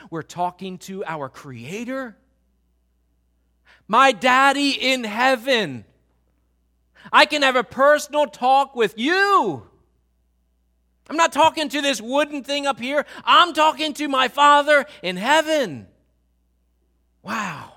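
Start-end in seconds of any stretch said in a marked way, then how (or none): 3.9-15.9 louder and higher, fervent pulpit delivery; impassioned preaching. Acted. Pastor urging the church about prayer, full-force preaching. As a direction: preach it out to the congregation.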